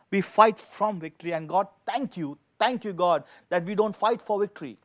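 ordinary talk

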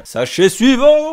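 slow, high, loud announcement; building excitement. Real, not acted. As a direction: build up the next packet. Announcing the next packet.

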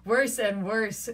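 loud and slow; mock dismay; amused gloating. A narrator's reading. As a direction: savour the rival's failure. Petruchio gloating.

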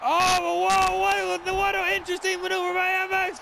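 high, rushed burst of amazement; stunned excitement. Real, not acted. Commentator stunned by a flip, high and fast. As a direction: caught off guard by the flip, gush it out fast.

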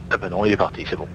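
ordinary talk, nothing marked

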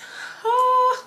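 high, loud voiced sigh; big sigh. Real, not acted. Vlogger about to tell her concert story, big sigh.

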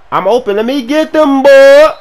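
high call building to a holler; eager yelling. Real, not acted. Open player demanding the pass, eager yelling. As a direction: shout eagerly for the throw. Calling for ball at top voice.

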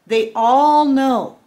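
They all know: "all" loud and drawn out, slowly; stress on all. Real, not acted. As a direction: lean hard on "all", slow and loud. Stressing betrayal to viewers.

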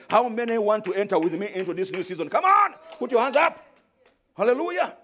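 high, loud call to raise hands; preacher urging the church to raise hands; preaching call. Acted. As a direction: rouse the congregation. Calls congregation.

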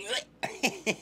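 high retching noise; mock retch; gagging blech. Real, not acted. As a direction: gag like it's disgusting. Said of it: "I'm gagging".

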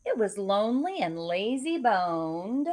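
put-on lively rhyming read-aloud for kids; story narration. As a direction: read it like a bedtime picture book. Reads picture book aloud.

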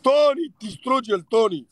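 high, loud rallying cry; excited cheering. Real, not acted. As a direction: egg him on to crush the rival. Cheering on a supporter in battle.

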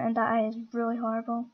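ordinary delivery

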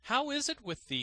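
high, teasing question; mocking gardener. A narrator's reading. Gardener taunts the dangling student, high and mocking. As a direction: taunt him sweetly.

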